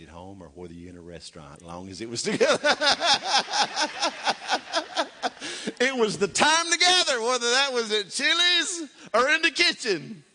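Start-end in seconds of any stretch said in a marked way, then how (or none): 2.6-5.7 loud, high laugh. Real, not acted. Preacher laughing about eating at Chili's, loud. 5.8-10.2 high, loud, laughing through the words. Real, not acted. Preacher joking to congregation about Chili's, laughing.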